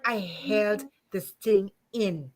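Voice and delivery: slow, heavy and emotional. Real, not acted. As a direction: drag it out, choked with feeling. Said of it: "I'm getting emotional".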